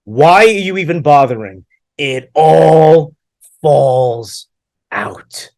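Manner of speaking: slow, drawn-out booming pronouncement; imitated menacing rabbi. Bald rabbi warning a student about hair, menacing boom. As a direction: stretch each word like a looming teacher. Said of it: "he gets into my face and he goes".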